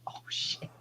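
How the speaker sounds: breathy whisper of alarm; whispered reaction. Retold or imitated. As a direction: whisper it like you just realized. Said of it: "I'm like".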